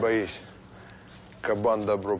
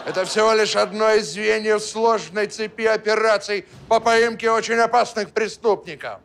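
stern officer voice; 10 semitones higher, 7 dB louder than usual. Acted. Kaban poses as a major.

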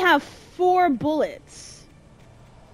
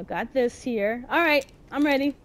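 ordinary talk

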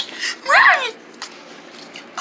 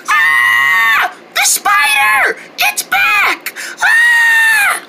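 high frightened shrieks at the spider; shrill kid terror; scared shriek; 13 semitones higher, much slower than usual. Acted. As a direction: scream in panic as it comes back. Kids spot the spider again.

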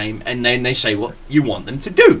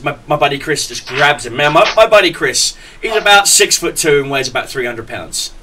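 usual speaking voice

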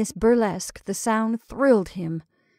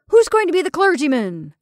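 calling out; 7 semitones higher, 6 dB louder than usual. Acted. Revellers calling out.

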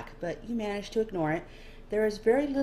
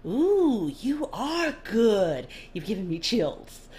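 higher, louder, very slow and relishing; delighted nobleman; 5 semitones higher, 5 dB louder, much slower than usual. Acted. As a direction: savour every word with mock delight. Dorian delighted.

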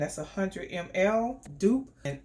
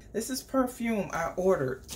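ordinary delivery

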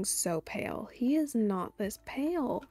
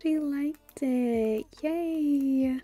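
higher, drawn-out happy cooing; delighted cheer; 4 semitones higher, 4 dB louder, much slower than usual. Real, not acted. Cheering the baby liking food.

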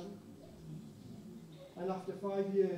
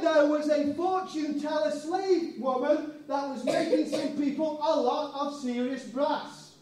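ordinary talk, nothing marked

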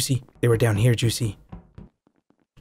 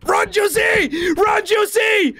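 panicked shout. Acted. Friend about to be caught.

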